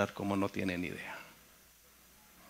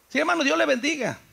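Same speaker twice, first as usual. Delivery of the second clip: brethren replying; 14 semitones higher, 5 dB louder, faster than usual. Retold or imitated. Brethren answering the departing preacher.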